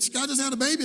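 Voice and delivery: high, loud rising disbelief; can't believe the news; stunned father's loud question; astonished question. Retold or imitated.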